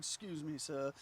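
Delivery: put-on suave French accent, softly spoken; soft, smooth French-accented host; French debonair host. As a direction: play a debonair French maître d'. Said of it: "you know, very French debonair".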